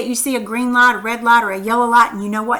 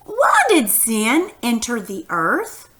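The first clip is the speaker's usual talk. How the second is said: slow question, "earth" rising at the end; question intonation; slower than usual. Shown as a demonstration. Teacher showing pupils how a question sounds, rising on "earth". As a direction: lift "earth" into a clear, unhurried question. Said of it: "this is how you would say it".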